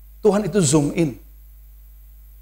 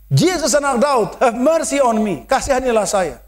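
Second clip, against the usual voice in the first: pleading cry; 5 semitones higher than usual. Retold or imitated. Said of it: "semakin dia berteriak minta pertolongan". (seruan memohon belas kasihan)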